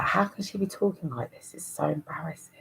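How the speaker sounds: hushed whisper to herself, cringing; embarrassed whisper. Retold or imitated. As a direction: whisper it as a mortified schoolgirl. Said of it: "I was like".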